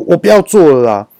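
retold outburst; mock angry quit. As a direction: throw it down like quitting. Employee quitting in anger.

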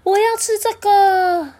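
put-on high, loud and slow childlike yell; big eager cry for the pudding; excited shout. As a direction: shout out that you want it now. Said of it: "大喊说".

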